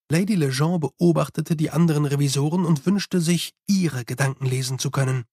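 ordinary delivery, nothing marked

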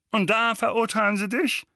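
high and loud gloating; gloating uncle. A narrator's reading. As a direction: relish the idea. Vernon hopes Harry is punished.

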